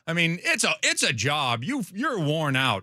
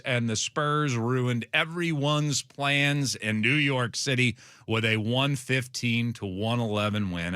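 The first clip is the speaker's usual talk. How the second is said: ordinary talk, nothing marked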